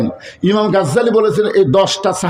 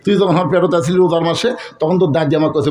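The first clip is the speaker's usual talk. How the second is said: usual speaking voice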